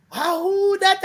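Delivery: high, startled who that; frightened call. Retold or imitated. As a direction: call out scared into the dark. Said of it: "And the person say".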